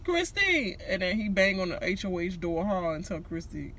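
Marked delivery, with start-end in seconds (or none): 0.0-0.8 much higher yelled name; calling out. Retold or imitated. Nick banging on the door, high yelling call. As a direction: call through the door at her. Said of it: "Nick bangs on the door".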